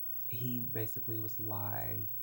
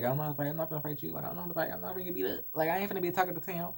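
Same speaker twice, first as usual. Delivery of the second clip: panicked retelling; 8 semitones higher, much faster than usual. Retold or imitated. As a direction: voice jumps high, quick and pleading at the end.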